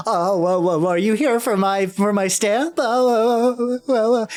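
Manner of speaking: high, loud bewildered clown; confused clown voice. Acted. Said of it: "puts on a confused one".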